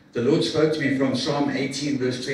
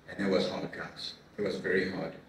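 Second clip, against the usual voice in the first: low, soft and heavy, lower and softer; hushed sorrow; 6 semitones lower, 7 dB quieter than usual. Real, not acted. Telling the church about his wife leaving, softly sad. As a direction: let the pain pull the voice down.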